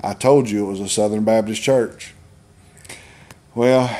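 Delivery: normal talking voice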